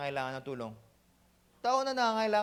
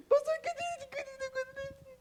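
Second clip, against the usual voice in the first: high, sobbing, hammed-up crying voice; mock crying sister. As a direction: whimper it like a crying old woman. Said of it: "parang nanay ko eh". (pabirong umiiyak na boses ng matandang babae)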